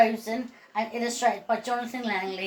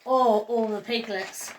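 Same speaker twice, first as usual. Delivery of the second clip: ordinary delivery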